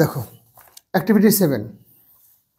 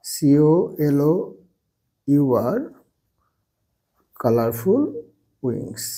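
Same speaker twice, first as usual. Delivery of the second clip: A butterfly has colorful wings, lower, softer, spelling C O L O U R; slow dictation with spelling; 6 semitones lower, 4 dB quieter, much slower than usual. Shown as a demonstration. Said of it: "উত্তর কি হবে". (ধীরে বানান করে লেখানো)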